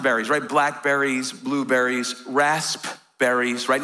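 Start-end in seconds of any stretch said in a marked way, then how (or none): 2.3-3.7 raspberries with a clear, slow P; P sounded in raspberries. Shown as a demonstration. Pastor teaching the congregation to say the P. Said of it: "Nobody ever uses the P in raspberry".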